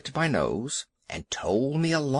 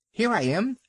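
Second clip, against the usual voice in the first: high yappy little dog; yapping dog; 9 semitones higher than usual. Acted. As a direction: yap it up high like a small terrier. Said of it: "yapped Vixen".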